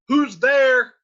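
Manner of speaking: high, loud call toward the door. Acted. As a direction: shout it out to whoever is knocking. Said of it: "talking back and forth to the door".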